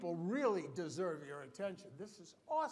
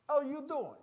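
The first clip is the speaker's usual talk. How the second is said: New Jersey Sopranos greeting; 8 semitones higher, 7 dB louder than usual. Retold or imitated. Speaker showing how New Jersey folks talk, hammed-up New Jersey accent. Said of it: "yes, we all talk like that".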